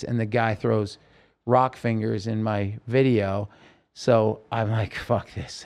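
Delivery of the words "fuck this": muttered curse. Retold or imitated. Muttered in frustration during the video.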